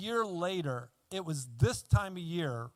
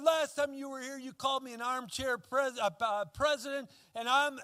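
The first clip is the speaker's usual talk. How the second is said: angry; 7 semitones higher than usual. Retold or imitated. Fred angrily complaining.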